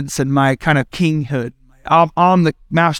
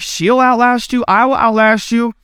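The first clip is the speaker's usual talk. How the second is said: higher, slowed parent vow; pitched-up, slow mock threat; determined parent; 4 semitones higher, slower than usual. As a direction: insist you and your wife will win. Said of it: "I'll tag my wife".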